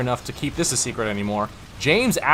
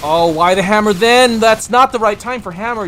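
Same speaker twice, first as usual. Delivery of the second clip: exasperated outburst; 7 semitones higher, 9 dB louder than usual. Real, not acted. Caster reacts to bad play.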